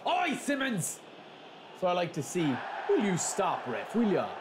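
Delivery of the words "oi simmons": high, excited call. Real, not acted. Gamer cheering his player on, high excited call.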